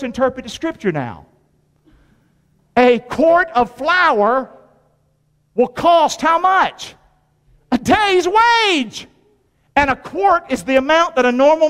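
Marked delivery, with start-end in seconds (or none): 2.8-9.1 drawn out, climbing higher with each phrase; slow emphatic preaching. Acted. Preacher hammering the cost of flour to the congregation, slow and rising high. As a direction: lean on every word and let it hang. Preacher driving home the price.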